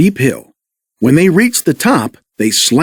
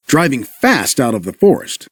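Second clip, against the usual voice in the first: usual speaking voice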